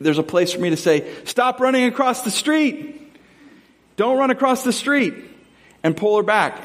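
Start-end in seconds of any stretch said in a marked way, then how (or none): 1.2-5.1 high urgent parental call; a parent snapping a warning to a kid running across the street; parent warning child. Retold or imitated. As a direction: call out to stop a child in the road. Parent stopping child crossing street.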